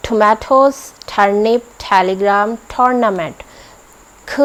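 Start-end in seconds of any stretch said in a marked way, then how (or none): 0.1-3.3 टोमेटोज़ with ठ instead of ट; ठ-sound t; aspirated t. Shown as a demonstration. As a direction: hit each t with a puff of air. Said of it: "उसको हम ठ बोलते हैं".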